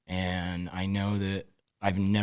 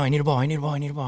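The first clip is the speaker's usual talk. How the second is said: higher, louder, urgent and insistent; a hurt quarterback begging for a ball; eager demand; 7 semitones higher, 4 dB louder, much faster than usual. Retold or imitated. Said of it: "He goes".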